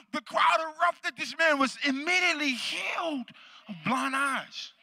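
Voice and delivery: high, loud, triumphant proclamation; full-force preaching. Real, not acted. Pastor telling the church of a healing, triumphant preaching.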